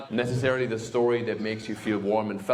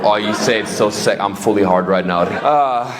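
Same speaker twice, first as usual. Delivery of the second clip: mock scolding; 2 semitones higher, 7 dB louder than usual. Scolding late crowd member.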